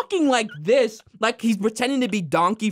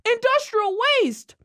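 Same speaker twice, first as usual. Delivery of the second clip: much higher excited Milhouse voice; imitating Milhouse; 13 semitones higher than usual. Retelling Milhouse at the factory, high excited kid voice. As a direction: squeal it with kid delight. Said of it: "Milhouse is like".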